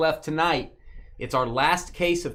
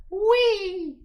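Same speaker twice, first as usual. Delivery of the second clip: long, high squealed wee; playful high "wee"; 3 dB louder than usual. Pretend. Host calls out team list, high playful squeal. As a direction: hold a high-pitched wee. Playful cry after calling Chris.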